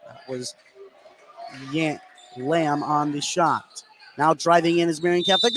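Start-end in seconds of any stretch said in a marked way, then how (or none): none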